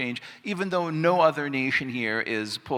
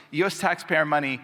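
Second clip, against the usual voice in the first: higher-pitched first label; quoted meme caption; 4 semitones higher than usual. Retold or imitated. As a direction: read the caption up high, falling at the end. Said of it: "And the meme is like".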